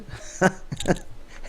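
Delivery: soft, airy burst of laughter; breathy laugh. Real, not acted. Amused at the manager's sly request, an airy laugh.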